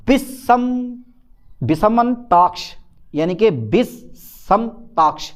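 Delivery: "विषमंताक्ष" drawn out very slowly, each syllable spaced; teacher dictating a hard Hindi term; slow dictation. Shown as a demonstration. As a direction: spell out "विषमताक्ष" slowly for the class. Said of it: "ऐसा पढ़ लो इसको".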